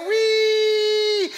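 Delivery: a very high, loud thrilled shriek; shrieked yes. Retold or imitated. Girlfriend confirms her plan, a thrilled high shriek. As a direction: squeal it with joy.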